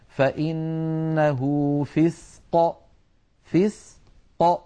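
slow recitation, "فسق" ending in a popped qaf; bouncing qaf release. Shown as a demonstration. Teacher reciting an example, slow with released final qaf. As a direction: draw out "فإنه", then bounce the ق of "فسق". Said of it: "فطرفا المخرج يبتعدان عن بعضهما".

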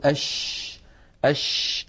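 "أش" with a long hissing sh, breath flowing freely; drawn-out airy "أش"; sheen with airflow. Shown as a demonstration. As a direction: hold the sh of "أش" and let the air stream out. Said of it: "نجد بأن الهواء يتدفق مع حرف الشين".

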